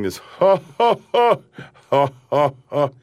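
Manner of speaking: ha ha ha, mocking the husband; imitating the laughing chorus. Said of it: "while the guys are laughing".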